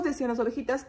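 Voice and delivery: usual speaking voice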